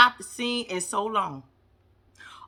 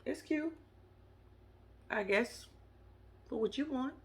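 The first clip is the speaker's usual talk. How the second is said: much softer, grudging half-hearted compliment; unimpressed murmur; 17 dB quieter than usual. Retold or imitated. Siding with Muhammad about the venue, muttered grudging praise. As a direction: mumble it with no enthusiasm.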